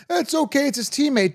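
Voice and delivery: high, mock soothing voice of the softies; mocking imitation. Mocking the fans who excuse Stroud, in a high mock voice. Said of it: "Some people are like".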